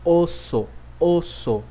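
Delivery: osso with a closed O at the end, never U; Italian O sound. Shown as a demonstration. Teacher correcting Brazilian students, clear final O. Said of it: "Essa palavra é".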